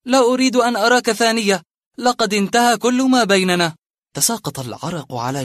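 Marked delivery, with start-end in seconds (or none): 0.0-3.8 loud and high, curt dismissal; dry exclamation. Acted. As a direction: cut him off for good. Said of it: "هتف في جفاف".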